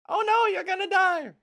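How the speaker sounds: high, loud mock-panicked woman. Woman runs up to dying villain, high mock panic. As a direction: shriek it like a frantic woman.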